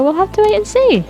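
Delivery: ordinary delivery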